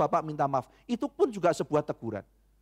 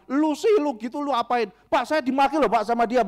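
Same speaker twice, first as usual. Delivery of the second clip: higher and louder, complaining workers; attendants' protest; 7 semitones higher, 6 dB louder than usual. Retold or imitated. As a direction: play the attendants crying foul. Voices other attendants taking sides. (protes para tukang parkir, tinggi dan keras)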